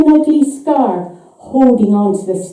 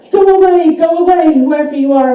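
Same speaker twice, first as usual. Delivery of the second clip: put-on frightened old man's cry, pitched higher and louder; rasping in fright; 4 semitones higher, 4 dB louder, faster than usual. A narrator's reading. Frozen old farmer rasps at the scratching door, terrified. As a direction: beg it to go, terrified, voice raised. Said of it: "rasps Fred".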